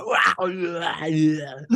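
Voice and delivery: long drawn-out mock "waaah"; imitated crowd jeer. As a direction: mimic rowdy fans jeering back. Imitates jeering fans behind him.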